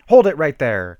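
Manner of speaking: loud halt order, firm; commanding shout. A narrator's reading. Military guard stops the teens at the courtyard, loud command. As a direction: bark it loud like a soldier. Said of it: "commanded a military guard".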